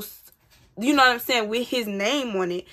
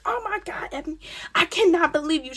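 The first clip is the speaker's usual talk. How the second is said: imitating mom scolding; 7 semitones higher, faster than usual. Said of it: "She trying to cuss me out".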